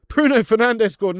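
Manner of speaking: high, loud burst of delight; thrilled to hear who scored; excited announcement. Real, not acted. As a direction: shout the scorer's name. Excited news of a goal.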